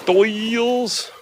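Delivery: very high, drawn-out DOYLE'S; exaggerated call. Tourist hamming up the restaurant's name, high drawn-out call. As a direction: stretch DOYLE'S long and high.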